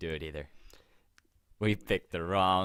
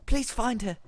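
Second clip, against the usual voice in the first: put-on high old lady plea; pleading Grandma Shallard; 4 dB louder than usual. Grandmother begging for Shallard's rescue, pleading. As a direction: beg in a high, overwrought voice.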